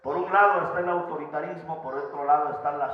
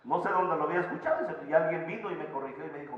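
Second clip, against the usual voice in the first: ordinary delivery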